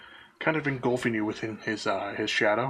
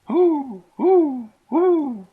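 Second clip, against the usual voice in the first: owl hoot; 8 dB louder, much slower than usual. Acted. Owl keeps hooting.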